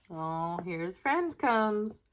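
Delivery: ordinary delivery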